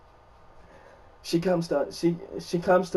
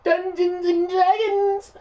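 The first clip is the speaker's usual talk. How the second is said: drawn-out, high, loud cheer; big stretched-out hype yell; excited shout; 11 dB louder, slower than usual. Real, not acted. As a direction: belt the name out like a hype announcer. Shouting out the game name.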